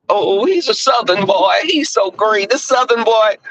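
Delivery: put-on high, sing-song put-down of a Southern boy; a mild mock of a snob sneering at a country boy; mocking condescending outsider. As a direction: talk down to him like a patronising city type. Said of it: "people have this conception".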